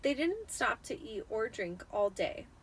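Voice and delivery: ordinary delivery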